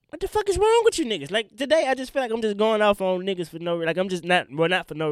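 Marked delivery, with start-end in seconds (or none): 0.1-1.3 high, loud disbelieving yell; real exasperated shout; exasperated outburst. Real, not acted. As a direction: let it burst out, fed up.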